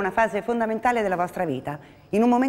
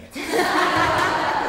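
breathy laugh; 6 semitones higher than usual. Real, not acted. Teacher laughs at joke.

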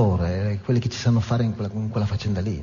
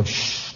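long hissing "shh"; imitated quenching hiss; 5 dB quieter than usual. Storyteller describing the harpoon's tempering, imitated hiss. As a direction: hiss like hot iron in water.

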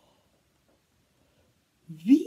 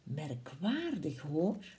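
lower, much softer, slow musing Gruffalo; puzzled Gruffalo; 5 semitones lower, 7 dB quieter, slower than usual. Acted. Gruffalo wonders why the animals flee, low and soft. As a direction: mull it over quietly like a big slow beast. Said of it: "De Gruffalo zei".

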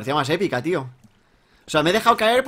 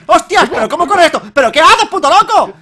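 high, loud yells of disbelief; alarmed shouting; 9 semitones higher, 10 dB louder than usual. Real, not acted. Player surprised by fast creeper, alarmed shouting. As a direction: shout at the thing charging you.

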